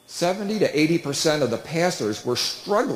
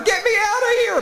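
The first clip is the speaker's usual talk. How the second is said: much higher, louder retold cry for help; panicked yell. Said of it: "he starts yelling louder".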